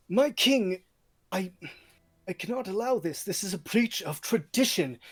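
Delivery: high, loud protest to the king; outraged protest. Acted. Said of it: "Arnulf shoots out of his chair".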